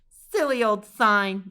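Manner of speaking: loud, slow, dismissive scoff; old lady mocking the sign. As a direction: brush off the warning with contempt.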